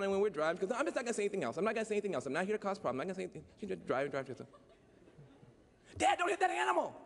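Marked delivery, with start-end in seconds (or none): 6.0-6.9 mock alarmed friend. Imitating friend in the car.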